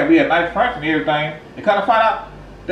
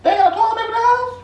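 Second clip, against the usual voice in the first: much higher, squeaky disbelief; shocked question. Acted. Shocked at stolen toilet paper.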